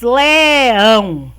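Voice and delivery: LEÃO stretched loud and long in one glide; "Leão" as one long, emphatic word; drawn-out word. Shown as a demonstration. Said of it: "duas sílabas".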